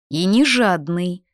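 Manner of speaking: НЕ ЖАДНЫЙ said slowly, with weight; weighty. A narrator's reading. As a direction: drop «не жадный» deliberately, as a firm verdict. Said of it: "веско уронила Клара". (веско и медленно: «не жадный»)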